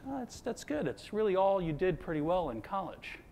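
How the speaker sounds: high, soft, snarky Long Island mom voice. Retold or imitated. Son retells his mother's backhanded praise, snarky Long Island mom voice. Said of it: "It's that snarky".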